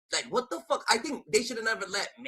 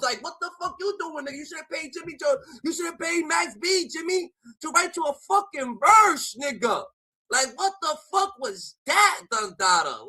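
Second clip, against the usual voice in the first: shouting in disbelief; 7 semitones higher than usual. Real, not acted. Ranting at [name]'s diss track.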